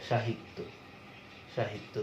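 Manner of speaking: সাহিত্য as "shahitto", beginning with sh; palatal sh. Shown as a demonstration. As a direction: say the স in সাহিত্য as sh, low and measured. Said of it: "উচ্চারণ করছি তালব্য স দিয়ে".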